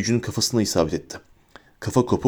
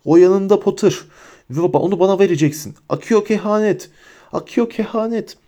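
much higher, urgent voice; frantic demand; 9 semitones higher than usual. A narrator's reading. Bellatrix tries to summon the prophecy from Harry, frantic. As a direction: insist desperately in a raised pitch.

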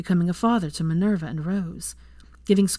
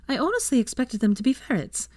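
higher, playful teasing; 8 semitones higher than usual. A narrator's reading. Hermione teasing Draco about ferrets. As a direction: tease him. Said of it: "she teased".

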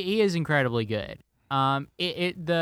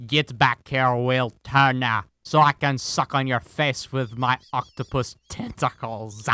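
hammed-up sea captain, words stretched out; Davy Jones impression; 5 dB louder than usual. Podcaster doing a movie line as the villain, hammed-up and loud. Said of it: "Can you say that line, though, as him?".

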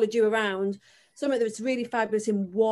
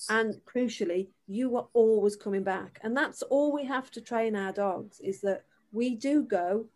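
ordinary talk, nothing marked

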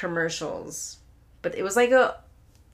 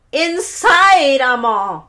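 high, loud outburst of frustration; exasperated shout; 5 semitones higher, 10 dB louder, much slower than usual. Retold or imitated. Yelling back at mom that it's the inside settings, exasperated. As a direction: blurt it out, fed up and exploding.

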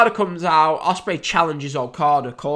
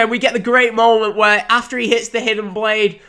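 ordinary talk, nothing marked

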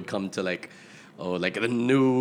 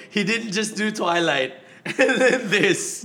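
laughing; 8 semitones higher than usual. Real, not acted. Defending Pattinson, laughing.

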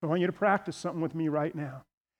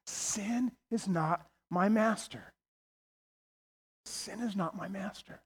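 hushed, much softer voice; whisper; 8 dB quieter, slower than usual. Shown as a demonstration. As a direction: whisper it as if to yourself. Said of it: "I want you to whisper every day".

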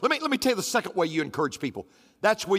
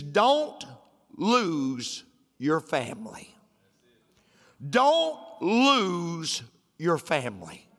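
slow solemn warning; 2 semitones lower, much slower than usual. Acted.

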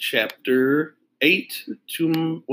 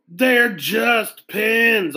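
higher, very slow, stretched out shout; exasperated shout; 10 semitones higher, 3 dB louder, much slower than usual. Acted. Boy fed up with the pen salesman, slow exasperated shout. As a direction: drag it out, fed up with the questions. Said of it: "Arlo shouted".

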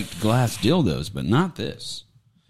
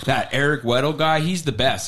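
ordinary delivery

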